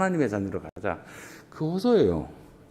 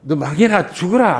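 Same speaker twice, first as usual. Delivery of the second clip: mock curse; 5 semitones higher, 4 dB louder than usual. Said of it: "안 해요".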